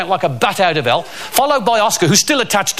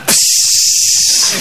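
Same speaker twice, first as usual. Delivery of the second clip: sharp unvoiced hiss to shoo a rat; loud shooing hiss; hissing psst; 4 dB louder than usual.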